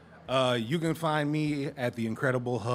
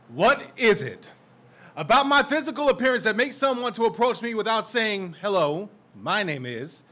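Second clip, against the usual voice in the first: loud, charged spoken-word delivery; slam poetry; 8 semitones higher, 9 dB louder than usual. Acted. Poet confronting the crowd about race, loud spoken word. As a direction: drive each question at the audience.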